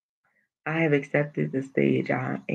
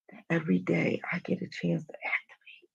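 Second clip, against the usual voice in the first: whispered; 8 dB quieter than usual. Real, not acted. Emphatic life advice.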